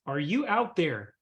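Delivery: ordinary talk, nothing marked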